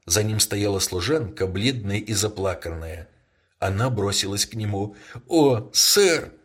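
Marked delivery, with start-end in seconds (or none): 5.3-6.3 exclaiming. A narrator's reading. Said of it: "воскликнула она и зарыдала".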